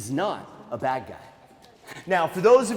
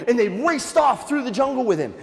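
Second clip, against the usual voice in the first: ordinary delivery, nothing marked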